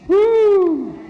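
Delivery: high loud whoop; excited whoop. Real, not acted. Teacher cheering a student's bold deletion, excited whoop. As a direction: cheer the class on.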